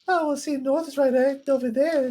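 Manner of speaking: very high-pitched cartoon character; tiny high character voice; Bitsy voice. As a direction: put on a high squeaky little voice. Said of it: "Bitsy, which way is north".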